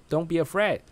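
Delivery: ordinary delivery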